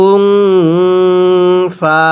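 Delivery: ওয়াল উনসা with the ন hummed long through the nose, very slow; nasal ghunnah recitation. Shown as a demonstration. Teacher demonstrating ghunnah on নুন সাকিন, slow nasal chant. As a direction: hold the nasal hum in উনসা. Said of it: "গুন্নাহ করে পড়ছি".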